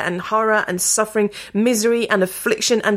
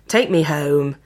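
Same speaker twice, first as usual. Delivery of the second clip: ordinary delivery, nothing marked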